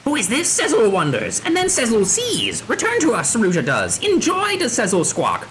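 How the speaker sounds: theatrical squawky beastman; squawking bird creature. Acted. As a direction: play an excitable bird-person with odd syntax. Voices Sezzle, squawking bird creature.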